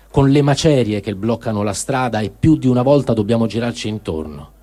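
plain reading voice

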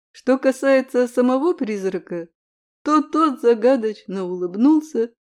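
ordinary delivery

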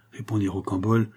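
ordinary delivery

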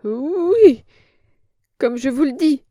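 put-on a cringing old woman protesting; frightened old crone. A narrator's reading. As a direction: plead like a scared old hag.

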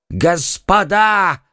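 high, drawn-out desperate call; wailing cry. A narrator's reading. Terrified haberdasher bursts in on the friends, wailing. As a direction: cry out for help, stretching the word slowly. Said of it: "взвопил он".

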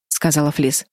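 ordinary delivery

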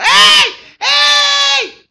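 very high, loud drawn-out yell; shout. Acted. Blind man calls to Jesus, shouting. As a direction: open up and yell full out. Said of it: "empezó a gritar".

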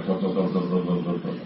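a soft, shaky, stammering "تو، تو، تو"; mock trembling. Israfil trembling before God, a mild quiet stammer. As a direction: stutter it as if quaking with fear. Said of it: "وہ ایسے کانپے گا".

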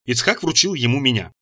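plain reading voice

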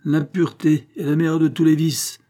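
acted pompous moral lecture; sententious. A narrator's reading. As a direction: cut in with a preachy pronouncement. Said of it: "interrompit sentencieusement mademoiselle Angélique".

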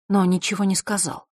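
plain reading voice

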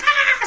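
very high short shriek; mock scream. Woman falling into the pool: very high shriek. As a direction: shriek as she falls. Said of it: "she's just like".

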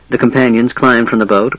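ordinary delivery

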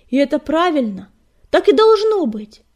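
loud, climbing high on the close; emphatic conviction. A narrator's reading. Fireheart concludes his defence to clan, emphatic. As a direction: drive the verdict home.

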